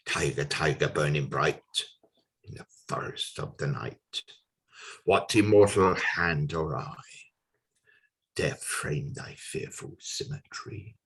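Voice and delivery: low, soft verse sinking towards a whisper; hushed poem recitation. Acted. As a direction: bring the closing verse down to a hush.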